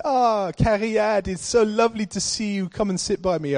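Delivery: a hint of a sweet old Welsh auntie welcoming him; elderly Welsh lady.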